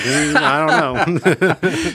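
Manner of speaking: high, slow, laughing doubt; doubtful hm. Retold or imitated. Husband joking about his wife's cooking, high and doubtful. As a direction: drag it out, dubious and amused. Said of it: "I'd be like".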